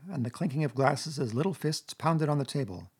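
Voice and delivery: plain reading voice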